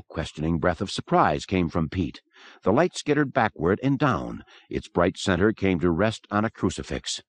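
ordinary delivery

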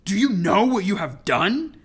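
angry hiss. A narrator's reading. Enraged captain confronting the girl, hissing loudly. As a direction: spit it out in loud, hissing anger. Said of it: "his voice a hiss".

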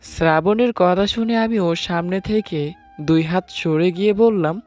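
plain reading voice

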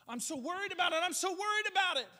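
high, loud whiny mockery; mock worrying. Preacher mocking a worrier's complaint, high whiny and loud. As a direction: ham up the fretting.

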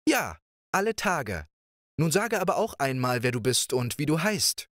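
playful princess. Acted. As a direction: coax him like a carefree young princess. Princess asks his name.

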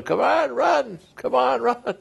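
very high, sustained mock holler; imitated yelling.

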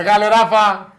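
loud, hearty shout-out; calling out. Real, not acted. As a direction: holler a cheer to a friend. Calls out to Rafa in studio.

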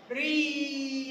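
long, held "ري" as "ree"; kasra sound. Shown as a demonstration. Teacher showing kindergartners the kasra sound, "ري" drawn out as "ree". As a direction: stretch the ee vowel in "ري". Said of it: "يلا نكسر بقنا كده بصوت الحرف".

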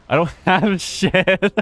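real laughter at having nothing; laughing. Real, not acted. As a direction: crack up mid-line. Laughs at own empty inventory.

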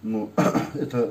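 ordinary talk, nothing marked

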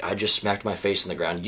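low, understated inner remark after a fall; subdued dazed reaction. Retold or imitated. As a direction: say it quietly, as if lying there stunned. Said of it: "I just really just lied there".

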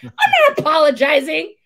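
high, loud, crying-edged refusal; whiny refusal. Retold or imitated. Recruiter refusing her senior's order, loud, high and whiny. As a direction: refuse like a sulking kid, near tears.

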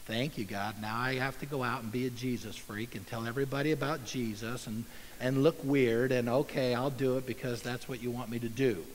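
low, soft, reluctant sigh of a prayer; grudging burdened believer. Retold or imitated. A believer grudgingly thanking God for the burden. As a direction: grumble it like a chore you resent. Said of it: "Like this".

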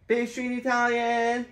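lifted high, proclaimed to the sky; sky-high announcer call. Shown as a demonstration. Father proclaiming to the kids, sky-high call. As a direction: call it upward like a big reveal. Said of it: "Put it up in the sky and say".

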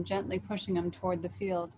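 ordinary delivery

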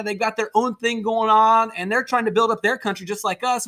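ordinary talk

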